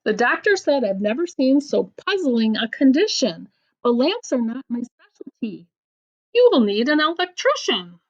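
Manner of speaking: lively storybook reading with voiced bits; picture-book read-aloud; reading to children. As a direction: read the silly poem to a child. Reads a funny rhyme book aloud.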